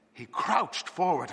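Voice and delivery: ordinary delivery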